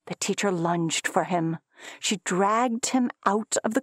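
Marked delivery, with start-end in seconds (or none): none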